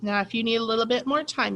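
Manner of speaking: ordinary delivery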